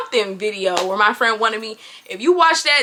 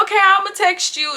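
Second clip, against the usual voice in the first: bright agreeable; 7 semitones higher than usual. Retold or imitated. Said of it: "I'm like".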